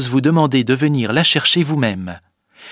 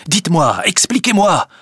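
agitated, rising higher; urgent demand; 7 semitones higher, 4 dB louder than usual. Acted. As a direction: press urgently for an explanation. Cornelius demands an explanation, alarmed. (supplication pressante et agitée)

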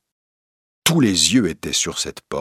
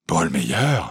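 stunned question. A narrator's reading. Accused man rises on hearing the name, stunned question. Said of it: "fit Robert Darzac en se soulevant".

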